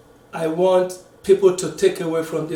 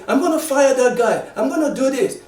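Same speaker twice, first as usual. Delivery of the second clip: mildly acted hotheaded outburst, higher pitched; going off angrily; 6 semitones higher than usual. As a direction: imitate a boss flying off the handle. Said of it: "don't just go off and say".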